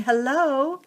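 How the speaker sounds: tiny creatures greeting from below; small crab voices. Acted. Crabs in an egg carton greet Bowie, high small voices. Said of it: "small voices came up from the carton".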